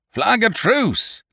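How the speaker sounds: high, long-drawn call; pirate hailing the fort; cried out. A narrator's reading. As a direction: call slowly across a distance. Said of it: "cried Silver".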